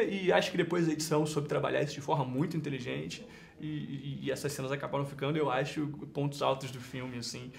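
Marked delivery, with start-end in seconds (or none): none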